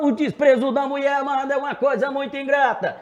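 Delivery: belted high and loud; show shout. Shown as a demonstration. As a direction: let it rip like on stage. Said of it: "Você vê como muda, né?".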